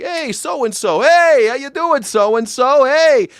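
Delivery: high, loud, slow hammed-up hello; mock-chummy cafe regular shouting his name; cheery greeting. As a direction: call out like a delighted regular.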